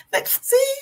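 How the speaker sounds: choked-up laugh breaking high; emotional laugh-cry. Real, not acted. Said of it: "Y'all making me emotional".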